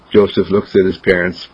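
plain reading voice

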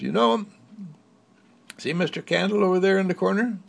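put-on a girl showing the others a secret; lightly voiced storybook girl; little girl. Marguerite points out candle.

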